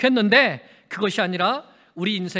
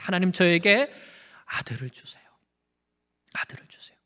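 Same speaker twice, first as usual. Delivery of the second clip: hushed prayer plea; 4 semitones lower than usual. Acted. Hannah's whispered plea for a son.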